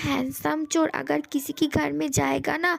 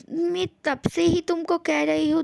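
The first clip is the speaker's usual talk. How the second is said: normal talking voice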